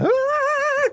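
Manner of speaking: piercing falsetto scream, sudden and short; sudden high scream. Shown as a demonstration. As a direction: shriek out of nowhere to startle them. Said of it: "kind of shit out of me".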